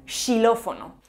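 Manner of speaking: "xilófono" starting with sh. Shown as a demonstration. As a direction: say "xilófono" as shilófono. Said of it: "o incluso como sh".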